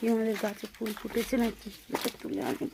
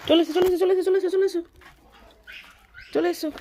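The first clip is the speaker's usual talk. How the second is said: high, loud repeated call; 10 semitones higher, 8 dB louder, faster than usual. Real, not acted. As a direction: call him over again and again. Calling the child repeatedly.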